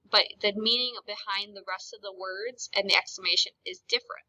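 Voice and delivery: ordinary delivery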